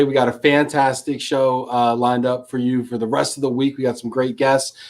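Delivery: ordinary talk, nothing marked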